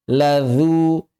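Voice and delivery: "ladzu" with a light dz, held long; thin dhal. Shown as a demonstration. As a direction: keep "dzu" thin at the teeth.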